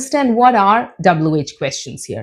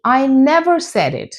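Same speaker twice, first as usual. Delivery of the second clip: usual speaking voice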